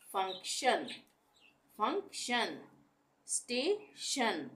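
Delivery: slow, careful शन ending; tion as shun. Shown as a demonstration. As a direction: finish फंक्शन and स्टेशन on a clear शन. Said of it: "टीआईओएन के लिए हम श का उच्चारण". (शन ध्वनि, धीरे से)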